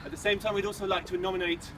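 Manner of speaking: ordinary talk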